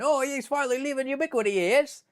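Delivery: high put-on accent voice, teasing; mock voice of Jason. Teasing viewer Jason about switching brands, high mock accent voice. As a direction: imitate a viewer gloating in a silly high voice. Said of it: "I just gave you that voice when you're Canadian".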